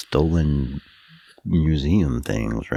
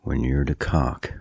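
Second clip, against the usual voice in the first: ordinary talk, nothing marked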